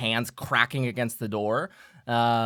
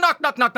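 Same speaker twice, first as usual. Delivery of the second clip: knocking sounds; 10 dB louder than usual. Retold or imitated. Imitating knocks again.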